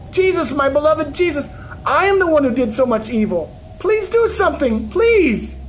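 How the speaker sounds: high-pitched, urgent imploring; desperate begging. A narrator's reading. Begging Jesus to save grandmother, crying out desperately. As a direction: beg desperately, voice rising high. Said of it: "I cried and cried as I begged".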